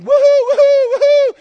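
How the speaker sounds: loud, high ecstatic whoop; joyful whoop. Acted. Optimist son finding his gift, whooping with joy. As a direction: let out a wild cheer of delight. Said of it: "just going".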